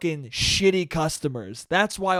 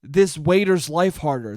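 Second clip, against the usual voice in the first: ordinary delivery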